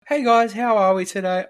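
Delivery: high, loud, sing-song fake cheer; mock sugary manager. Imitating the team leader's fake-friendly greeting, high and sweet.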